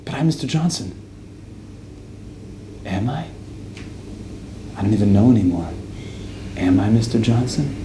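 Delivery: quiet, lost self-questioning; confused doubt. Retold or imitated. Man at the door questions his own identity, quiet and lost. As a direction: drift into doubt, softly.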